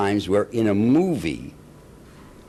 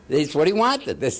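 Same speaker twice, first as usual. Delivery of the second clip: laughing; 6 semitones higher than usual. Real, not acted. Laughing while recalling director.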